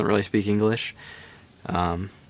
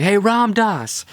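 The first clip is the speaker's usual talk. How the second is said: high, drawn-out street greeting; calling out on the street; 11 semitones higher, much slower than usual. Retold or imitated. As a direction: call his name across the street, slowly. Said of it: "there was people going".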